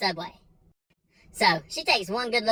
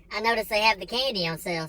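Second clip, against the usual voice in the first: usual speaking voice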